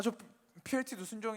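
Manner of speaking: ordinary talk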